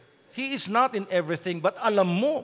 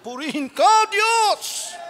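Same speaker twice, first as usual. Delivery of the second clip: high, raised cry of praise; joyful praise; 9 semitones higher, 6 dB louder, much slower than usual. Acted. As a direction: lift it up high to God, corrected from grumbling. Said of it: "Hindi yung".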